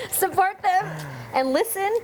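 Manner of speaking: high laughing repeat. Real, not acted. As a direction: giggle through the words.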